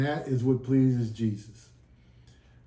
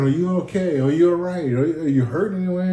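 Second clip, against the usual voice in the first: higher-pitched, mildly alarmed questions; lightly acted worry, pitch raised; retold worry; 5 semitones higher, faster than usual. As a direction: imitate a concerned nurse checking on a patient. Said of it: "And she got scared".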